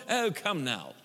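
put-on affected British accent, measured and proper; mock movie Jesus. Preacher mocking film Jesus, put-on proper British accent. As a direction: parody a prim British film messiah. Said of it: "He wasn't going".

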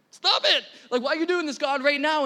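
loud, high-pitched playful outburst; mock protest. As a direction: protest in comic exasperation, pitched well up. Mock protest to God during tears.

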